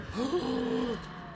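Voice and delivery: sharp high gasp of shock. Retold or imitated. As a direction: gasp as if you can't believe it. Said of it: "was like".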